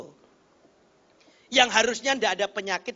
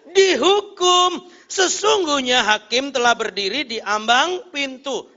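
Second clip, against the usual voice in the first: preaching scripture reading; 7 semitones higher, 10 dB louder than usual. Real, not acted. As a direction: declaim it with stretched, weighty words. Reads the verse aloud.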